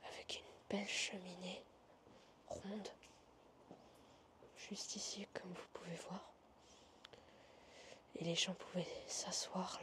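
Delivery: hushed exploring whisper; nervous night vlog whisper. Real, not acted. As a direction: whisper so nobody hears you. Exploring abandoned hotel, whispering.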